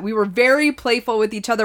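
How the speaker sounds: normal talking voice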